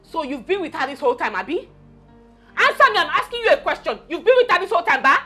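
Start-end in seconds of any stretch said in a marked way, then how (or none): 2.6-4.0 higher, forceful questioning; angry demand. Acted. Wife confronting cheating husband, angrily. 4.1-5.2 raised, higher-pitched demand; angry accusation. Acted. Wife confronting husband over his affair, angrily. Question repeated, now demanding.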